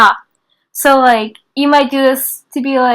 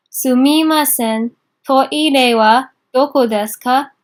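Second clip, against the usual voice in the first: slow model phrase; slower than usual. Shown as a demonstration. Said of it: "teacher models phrase slowly".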